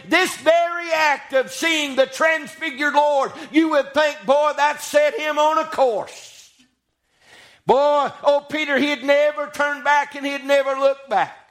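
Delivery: high-pitched, sustained preacher's cadence; full-force preaching. Real, not acted. Preacher marvels at Peter seeing the glory, preaching hard.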